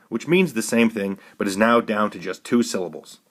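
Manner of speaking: ordinary delivery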